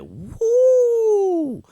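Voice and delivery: high, loud, drawn-out woo; imitated crowd "woo". Teacher imitating the class's reaction, loud high woo. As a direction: whoop like a shocked audience. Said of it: "everybody is like".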